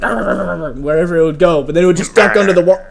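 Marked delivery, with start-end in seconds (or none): 0.0-0.7 higher, rapid growly barks; imitated barking dogs. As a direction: mimic dogs snapping after prey. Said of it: "the dogs would be like".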